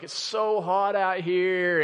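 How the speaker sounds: slow, drawn-out mimicked child's gripe; mock complaining. Dad imitating his son at T-ball practice, drawn-out complaint. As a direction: drag it out like a griping kid. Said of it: "he just began to complain".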